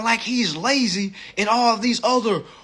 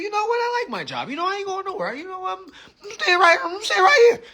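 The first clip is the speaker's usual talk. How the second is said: much higher, whiny, crying voice that breaks into a shout; mock tearful; 12 semitones higher, faster than usual. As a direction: blubber how much you love the job now. Worker mock-tearfully praising job.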